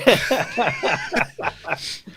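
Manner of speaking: high-pitched burst of laughter; a real, extended high laugh. Real, not acted. Laughs after the rant ends.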